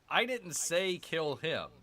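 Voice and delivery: "I" punched in "I didn't say kill him", rest slow; stress on I. Shown as a demonstration. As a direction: lean on "I", slow read. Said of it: "wherever you're emphasizing".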